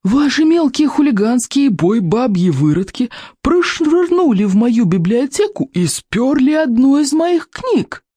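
put-on high, slow, whiny old man voice; a furious old bookkeeper, high and drawn out; cranky old librarian. A narrator's reading. Голос библиотекаря. (сварливый старик-библиотекарь, высоко и протяжно)

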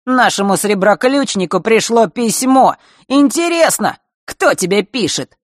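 loud, teasing and sneering; mocking taunt. Mark taunts Zakhara aloud.

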